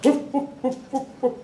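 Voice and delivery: high, soft mock woofs; playful imitation of a dog barking an answer; dog barks. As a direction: count out the number like a show dog barking. Dog barks the right number.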